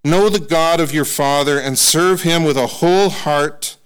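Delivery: slow, solemn reading of a father's charge; scripture reading. Acted. Preacher reading David's charge to his son, solemn and slow. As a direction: read it weightily, like a blessing.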